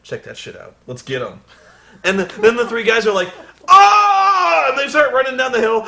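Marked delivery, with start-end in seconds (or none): 0.0-1.4 lower, much softer scheming mutter; sly low aside. Retold or imitated. Alexander plotting the attack. 3.7-4.7 much higher, louder charging yell; battle cry. Retold or imitated. Soldiers charging down the hill.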